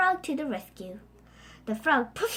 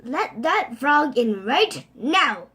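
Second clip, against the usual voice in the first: slow, loud stern command; fierce father; 8 dB louder, slower than usual. Acted. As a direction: order it firmly. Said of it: "said her father fiercely".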